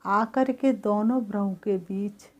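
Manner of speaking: calm, even meditation guidance; guided meditation. Acted. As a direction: lead the soul gently inward and back. Leads a guided soul meditation.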